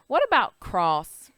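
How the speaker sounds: normal talking voice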